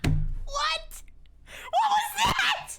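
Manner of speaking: very high, loud squeal of disbelief; helplessly laughing through the words; hysterical laughter. Real, not acted. Streamer reacting to Santa's New York accent, squealing with laughter.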